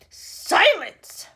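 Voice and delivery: loud sharp command; barked command. A narrator's reading. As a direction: bark for silence. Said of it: "barked Missus Tibbs".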